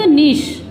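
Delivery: "नीश" as neesh; long ee, final sh on "नीश"; "नीश" modelled slowly for learners; British pronunciation. Shown as a demonstration. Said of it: "British English में".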